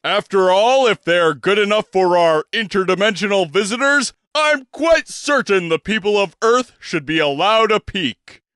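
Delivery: a pompous stage gentleman's introduction; grand old showman. Acted. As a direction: present yourself like a theatrical impresario. Bostwick continues grandly.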